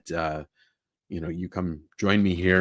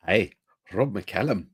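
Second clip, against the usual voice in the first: put-on playful Scottish accent greeting. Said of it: "I do a better Scottish accent".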